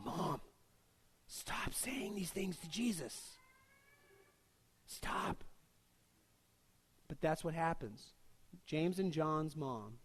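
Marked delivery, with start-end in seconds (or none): 0.1-5.4 soft, slow embarrassed plea, nearly whispered at the end; embarrassed hush. Retold or imitated. Sons hushing their mom in front of Jesus, mortified whisper. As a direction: hiss it like a mortified son shushing his mom.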